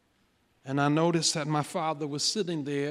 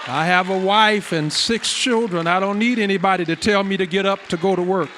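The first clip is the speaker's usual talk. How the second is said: loud, raised preacher's delivery of a father's words; preaching at full force; 4 semitones higher, 10 dB louder than usual. Retold or imitated. Father tells son why he needs no alarm, preached forcefully. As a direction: declare it loudly to the congregation, higher pitched.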